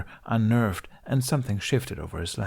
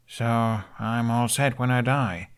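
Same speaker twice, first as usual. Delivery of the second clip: unenthused; 2 semitones higher, slower than usual. A narrator's reading. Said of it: "He sounded anything but enthused".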